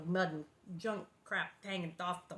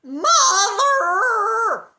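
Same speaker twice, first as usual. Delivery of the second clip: very loud high shriek; mock distressed scream; yell; 13 semitones higher, 18 dB louder than usual. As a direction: scream for Mother. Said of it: "what I said on the show".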